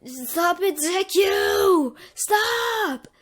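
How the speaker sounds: high, loud desperate shout; pleading shout. Acted. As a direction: scream at him to stop. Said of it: "he's like".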